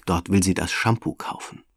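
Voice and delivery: usual speaking voice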